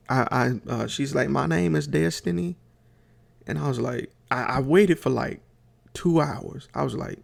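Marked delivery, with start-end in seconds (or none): 1.3-2.6 imitated country twang, lifted in pitch; playful mimicry of a very country twang. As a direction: say it with a higher, drawn Southern country twang. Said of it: "Destiny has the countryest twang".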